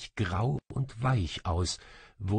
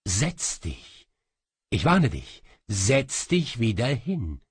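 angry command; 2 semitones higher, 5 dB louder than usual. A narrator's reading. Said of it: "sagte wütend".

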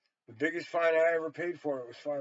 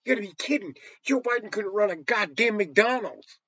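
louder, angry tirade against politicians; furious rant; 3 semitones higher, 7 dB louder than usual. Real, not acted. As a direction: rant and build the fury.

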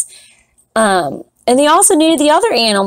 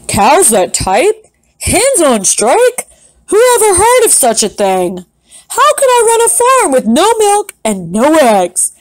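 outraged farmer; 6 semitones higher than usual. Acted. Farmer Brown can't believe his cows are striking, outraged. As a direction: rant like a fed-up farmer.

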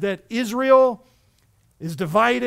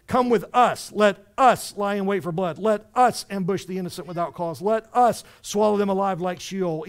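every "us" punched louder; stress on us; 4 dB louder than usual. Shown as a demonstration. Preacher pointing out the verse's emphasis, each "us" stressed. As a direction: hit "us" hard each time it comes. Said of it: "Now notice the emphasis here".

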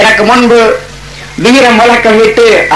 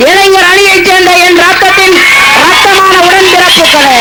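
rising, ringing rally address building to shouts; thunderous political oratory; 7 semitones higher than usual. Real, not acted. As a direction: hail the crowd like a stage orator. Rousing greeting to the crowd. (முழங்கும் அரசியல் மேடைப் பேச்சு)

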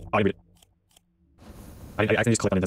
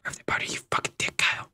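whisper; 6 dB quieter, much faster than usual. Real, not acted. Hushed fast exchange.

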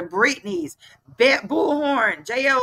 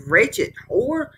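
ordinary talk

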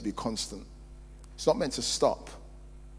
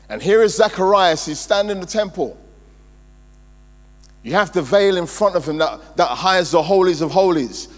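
loud, raised preacher's delivery, punching key words; high-energy sermon delivery, voice pitched up; forceful preaching; 5 semitones higher, 8 dB louder than usual. Real, not acted. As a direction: preach it with mounting force to the congregation.

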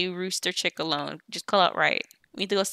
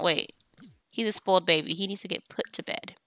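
ordinary delivery

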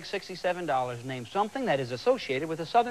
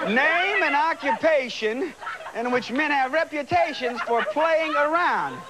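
slow amused reread; 6 semitones higher, slower than usual. Acted. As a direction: stretch each word out slowly, holding back a laugh. Host rereads after pimp answer.

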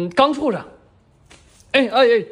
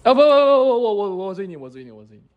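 flustered stammer; faster than usual. Acted. Flustered correction, fading out.

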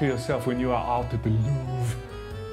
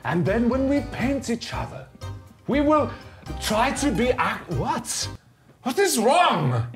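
hammed-up French art host accent; fake French accent; 4 semitones higher than usual. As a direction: play a pompous French artist, thick mock accent. Keeps the French voice.

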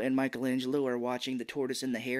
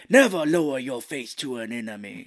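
Tatsu; 7 dB louder than usual. Retold or imitated. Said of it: "doing a bad Japanese voice".

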